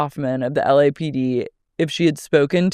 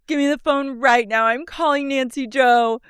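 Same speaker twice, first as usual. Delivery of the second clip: higher bossy imitation; mock demanding teen; 9 semitones higher than usual. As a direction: demand the phone like a spoiled girl. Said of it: "This goes".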